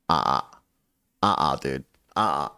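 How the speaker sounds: low, terse retold refusal. Refusing weed the morning after.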